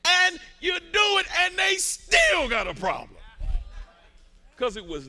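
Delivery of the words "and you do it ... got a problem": loud, high shouted sermon climax; preaching at full force. Acted. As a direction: lift the congregation with a roaring high build.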